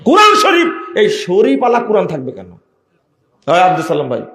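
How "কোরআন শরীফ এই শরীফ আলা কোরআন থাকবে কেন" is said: Quran Sharif flung out high, then scornful question; outraged at the word Sharif; indignant shout. Real, not acted. Preacher mocks the title angrily. (ক্ষুব্ধ চিৎকারে প্রশ্ন)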